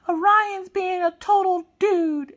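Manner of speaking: put-on high, loud, drawn-out little-girl whine; whining child. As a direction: whine slowly like an aggrieved kid. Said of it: "like, whining".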